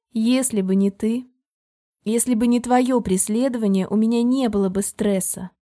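ordinary delivery, nothing marked

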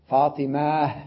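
ordinary delivery

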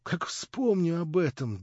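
plain reading voice

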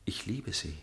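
soft tender declaration of love; tender confession. Acted. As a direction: let it slip out, tender.

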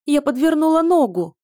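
high, loud pained wail; howling. A narrator's reading. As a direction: wail about the twisted ankle. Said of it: "взвыла принцесса нечеловеческим голосом".

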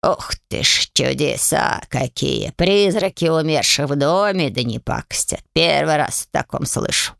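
low, slow voice full of wonder; amazed house spirit. A narrator's reading. House spirit hearing about the ghosts, lowly amazed. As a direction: marvel at the ghosts. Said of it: "удивился домовой".

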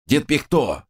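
irritable high retort; snapping back. A narrator's reading. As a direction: snap it back at him. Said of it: "огрызнулся я".

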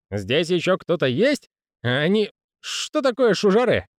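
jittery, slurring clerk voice; a hint of a tipsy panicked man; drunk nervous clerk.

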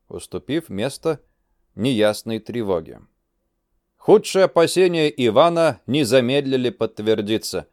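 ordinary delivery, nothing marked

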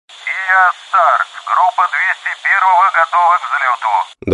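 pilot reporting over the radio. Acted.

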